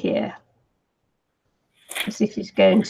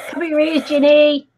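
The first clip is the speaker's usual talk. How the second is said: raised voice, higher pitch; calling out; 7 semitones higher, 3 dB louder than usual. Real, not acted. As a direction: call the warning across. Relaying warning to Ginny.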